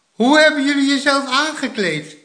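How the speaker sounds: high, loud amazement; astonished cry. A narrator's reading. Grandma seeing how the children dressed themselves, astonished cry. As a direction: exclaim in disbelief. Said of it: "riep oma verbaasd".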